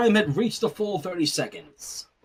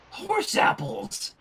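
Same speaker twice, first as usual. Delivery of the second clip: grumbled; 9 semitones higher, much slower than usual. A narrator's reading. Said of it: "Sunset grumbled".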